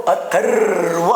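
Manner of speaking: अथर्व drawn out very slowly, leaning on the joined र; sounding out slowly. Shown as a demonstration. Teacher slowly sounding out अथर्व for his students. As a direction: stretch अथर्व slowly to show the र. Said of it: "म्हणून र".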